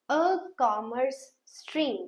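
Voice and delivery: "A commerce stream" drawn out very slowly; slow model phrase. Shown as a demonstration. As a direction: stretch it out for them. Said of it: "I hope I am very clear to you". (बहुत धीरे खींचकर बोलना)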